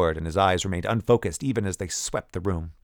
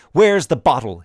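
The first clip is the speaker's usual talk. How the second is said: loud, high cry; cried out; 6 semitones higher, 10 dB louder than usual. A narrator's reading. Young man crying out at the woman. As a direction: cry it out in a demand. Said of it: "he cried".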